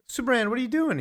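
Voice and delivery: high Lois. Retold or imitated. Lois voiced in retelling.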